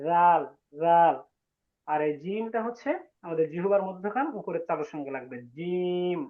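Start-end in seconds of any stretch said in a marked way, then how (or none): 0.1-1.2 slow zal, "জাল" with the tongue tip against the front teeth; careful Arabic zal. Shown as a demonstration. Teacher slowly modelling "জাল" with the tongue tip at the teeth for his students. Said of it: "ইজা হচ্ছে জিহ্বার আগা সামনের দুই দাঁতের আগার সঙ্গে লাগবে".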